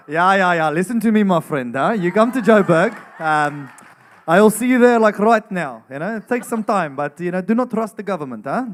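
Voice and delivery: loud, high South African accent. Shown as a demonstration. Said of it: "South African".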